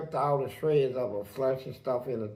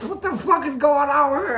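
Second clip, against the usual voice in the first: high, loud, incredulous question; baffled outburst; 12 semitones higher, 8 dB louder than usual. Retold or imitated. Man reacting to old victim's strange remark, baffled outburst. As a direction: burst out in disbelief.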